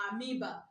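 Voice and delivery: amoeba said a-MEE-ba, no O sound; right pronunciation. Shown as a demonstration. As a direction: say amoeba with "oe" as a long ee. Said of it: "The right pronunciation is what?".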